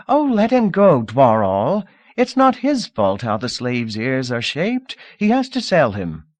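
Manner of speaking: a hint of a noble lady pleading lightly; lady's voice. Lady intercedes for auctioneer.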